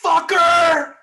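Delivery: full-out high yell; yelled movie quote. Retold or imitated. Quoting the hero's jump from the plane, yelled. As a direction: bellow it as one long, high battle cry. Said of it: "I love that he yells that".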